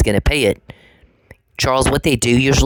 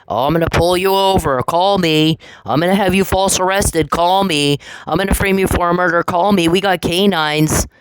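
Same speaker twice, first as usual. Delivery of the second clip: higher, taunting mock-cop voice; mocking Damien; 7 semitones higher than usual. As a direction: mimic him bragging with a sneer. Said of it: "we got Damien in his pullovers".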